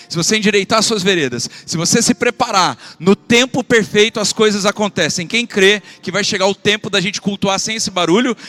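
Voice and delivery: building to a loud, high shout of faith; preaching climax. Acted. Pastor rallying the church with a loud, high preaching shout. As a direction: lift the church to a shouting peak.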